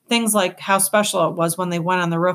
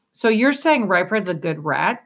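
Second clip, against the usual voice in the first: ordinary delivery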